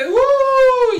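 sudden high, loud whoop of triumph. Real, not acted. After finishing the countdown for chat, a loud high whoop.

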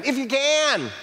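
high, exclaimed echo dropping at the end; thrown-back retort. Retold or imitated. Jesus throwing the father's statement back at him, high challenging retort. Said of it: "Jesus responds by throwing the statement back at him".

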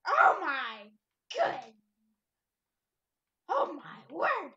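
loud cry of disbelief; real gamer shock; excited outcry. Real, not acted. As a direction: blurt it out, stunned and loud. Shock at game event.

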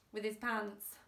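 plain reading voice

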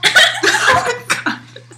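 high squealing laugh. Real, not acted. Reacting to baby food taste.

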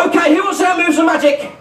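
put-on high, bouncy children's show host; kids party magician. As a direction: sell the fun to the kids. Magician working the crowd.

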